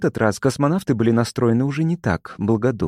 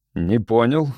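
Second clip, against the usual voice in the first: plain reading voice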